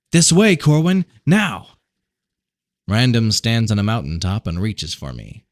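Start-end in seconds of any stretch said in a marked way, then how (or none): 0.1-1.8 higher, louder calling out; urgent call. A narrator's reading. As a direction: reach and call him over. Random calls him.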